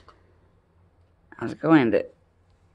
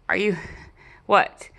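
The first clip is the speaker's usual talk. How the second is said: normal talking voice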